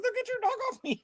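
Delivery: high hammed-up yell of a panicked woman; mock scream. The woman at the dam yelling about the dog, high mock scream. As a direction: squeal it like the frightened owner. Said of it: "She goes".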